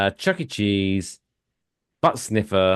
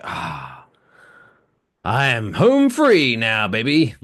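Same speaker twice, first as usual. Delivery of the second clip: smug traveler; slower than usual. Retold or imitated. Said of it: "he was like".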